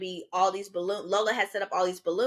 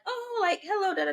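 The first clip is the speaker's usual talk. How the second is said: imitating a chirpy neighbour; 11 semitones higher than usual. Imitating the couple next to them.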